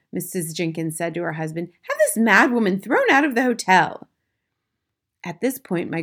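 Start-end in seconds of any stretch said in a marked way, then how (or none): 1.8-4.1 high, loud, outraged demand; indignant wife. A narrator's reading. Missus Jenkins urges her husband, loud and indignant. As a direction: raise pitch and volume, affronted.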